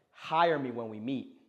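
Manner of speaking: "Hire me when we meet" with the pitch falling at the end; firm falling command tone on "Hire me when we meet"; downward tone. Shown as a demonstration. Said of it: "it has a downward, uh, downswing".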